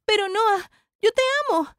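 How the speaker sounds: high, emotional protest of love; desperate plea. Acted. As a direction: plead against the breakup. Súplica de amor ante la ruptura. (súplica desesperada, en tono agudo)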